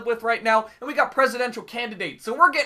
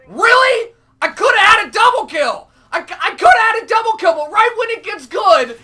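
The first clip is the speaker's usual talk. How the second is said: high furious shout; outraged yell; 9 semitones higher, 9 dB louder than usual. Real, not acted. Gamer raging at teammate who stole his kill, high shout. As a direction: yell in disbelief at the loss. Missed double kill rage.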